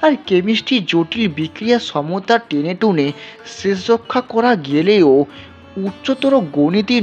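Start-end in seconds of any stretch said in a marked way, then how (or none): none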